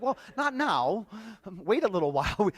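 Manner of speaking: put-on a hurried kid's take-back, giggling; imitating his young son. Son quoted backpedalling.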